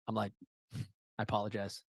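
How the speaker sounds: soft, choked-up apology; holding back tears; teary-eyed. Real, not acted. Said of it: "I'm getting a little teary-eyed".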